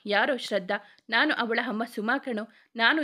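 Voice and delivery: usual speaking voice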